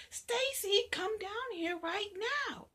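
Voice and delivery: high, loud mother's call. Acted. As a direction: yell up the stairs. Mother calls again.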